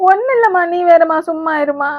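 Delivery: high, loud tearful voice through sobs; crying while brushing off the question. Acted. Mother-in-law asks why she cries, she answers high and tearful. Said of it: "ஏன் அழுவுற?".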